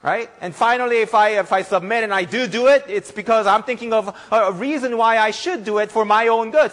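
loud, high fervent sermon delivery; impassioned preaching. Acted. Pastor preaching to church, loud and fervent. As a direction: preach it with full force to the congregation.

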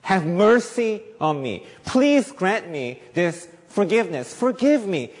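earnest pleading. Retold or imitated. Preacher voicing David begging God for mercy, slow and earnest. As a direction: beg for mercy slowly. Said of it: "earnestly pleading with God".

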